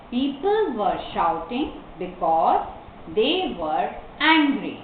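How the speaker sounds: slow answer in long phrases; model reading. Shown as a demonstration. Teacher gives the class the answer, slow reading. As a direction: pause before "they" and "angry".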